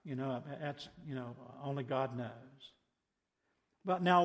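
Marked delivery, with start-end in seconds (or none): 0.0-2.3 low, halting mumble of doubt; trailing, soft-spoken reluctance to believe; skeptical hedging. Acted. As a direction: hem and haw, unsure and noncommittal. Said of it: "my first response is to be".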